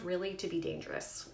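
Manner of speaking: usual speaking voice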